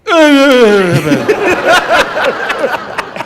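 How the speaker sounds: high loud laughing whimper, imitating a scared player; hammed-up scared laugh-cry; scared nervous laugh. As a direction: act out a terrified giggle that can't form words. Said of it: "He's so scared. This is what we got".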